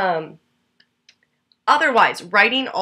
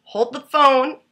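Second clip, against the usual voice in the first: lightly acted startled interruption, rising at the end; sudden realization; 2 semitones higher, 6 dB louder than usual. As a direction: halt the conversation with a building string of whoas. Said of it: "I just stopped dead in my tracks".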